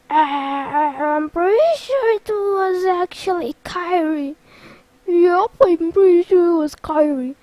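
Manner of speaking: hammed-up cartoon character voice; cartoon sidekicks. Goofy and Donald correcting Sora, cartoon voices.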